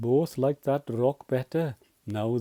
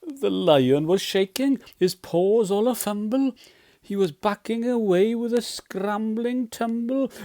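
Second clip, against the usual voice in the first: higher, louder, panicky storytelling; excited narration; 8 semitones higher, 4 dB louder than usual. A narrator's reading. Narrator tells of lion scrambling away from mouse, rising excited alarm. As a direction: build the panic as it climbs higher.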